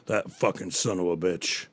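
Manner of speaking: low, quiet scheming grumble; a hint of a sly ape plotting; gorilla muttering. Gorilla eyeing the drinking lion, low and scheming. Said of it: "he goes".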